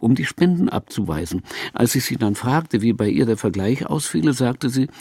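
plain reading voice

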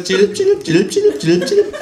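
very high, loud chilp chilp chirps; bird chirping. Acted. Psychiatrist hopping around, chirping like a bird. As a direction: tweet like a small bird. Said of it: "zwitschert der Psychiater".

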